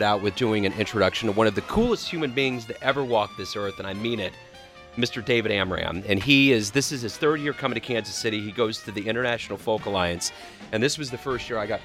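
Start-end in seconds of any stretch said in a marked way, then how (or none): none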